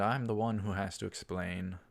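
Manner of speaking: usual speaking voice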